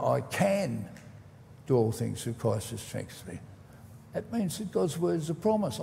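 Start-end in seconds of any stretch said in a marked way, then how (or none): 0.0-3.4 "can" held out and followed by a pause; stress on CAN. Shown as a demonstration. Preacher showing how to pronounce a verse word by word, stressing "can". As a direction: lean on "can", pause, then softer for the rest. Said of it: "each time emphasising a different word".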